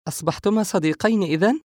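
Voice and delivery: high, lightly amused question; amused woman's question. A narrator's reading. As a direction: ask it high with mild amusement. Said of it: "سارة ضاحكة". (سؤال بنبرة عالية ومرحة قليلا)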